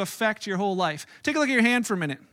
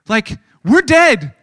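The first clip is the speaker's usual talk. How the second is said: mock panic; 2 semitones higher, 11 dB louder, much slower than usual. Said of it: "He's like".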